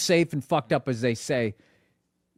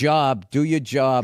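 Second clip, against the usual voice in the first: slow Boston accent demo; slower than usual. Shown as a demonstration. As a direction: draw out the Boston vowels. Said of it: "say the line again".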